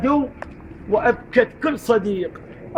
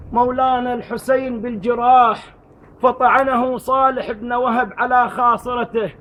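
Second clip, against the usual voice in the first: ordinary talk, nothing marked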